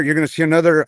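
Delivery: ordinary talk, nothing marked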